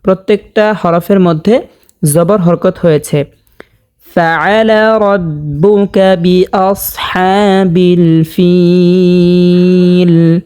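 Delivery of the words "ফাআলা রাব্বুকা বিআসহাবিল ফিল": slow, drawn-out melodic chant; Quran recitation. Shown as a demonstration. Teacher reciting the verse for viewers, slow melodic chant. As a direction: stretch each vowel in a sung recitation.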